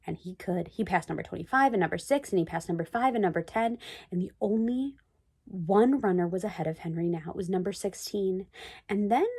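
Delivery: plain reading voice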